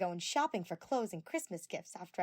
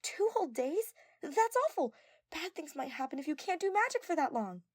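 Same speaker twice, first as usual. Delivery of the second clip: high, louder dismay; shocked; 10 semitones higher, 6 dB louder than usual. A narrator's reading. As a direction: gasp at the news. Tenko shocked.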